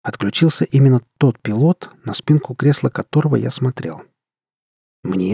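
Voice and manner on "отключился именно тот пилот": plain reading voice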